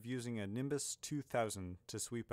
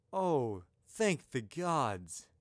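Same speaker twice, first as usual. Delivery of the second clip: loud, drawn-out exclamation of relief; heartfelt relief; 3 semitones higher, 6 dB louder, much slower than usual. Acted. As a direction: let the relief pour out slowly and loudly. Hero learns his belt is safe.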